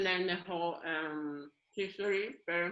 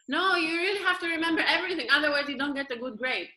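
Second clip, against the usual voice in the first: higher, louder insisting schoolgirl; insistent classmate; 6 semitones higher, 5 dB louder than usual. Retold or imitated. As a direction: press the point like a stickler friend. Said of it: "She said".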